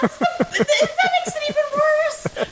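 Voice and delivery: high, laughing protest. Real, not acted. Host laughing back at the co-host's joke, laughing. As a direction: laugh out the objection.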